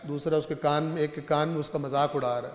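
normal talking voice

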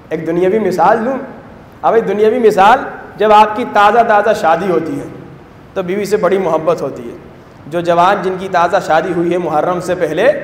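ordinary talk

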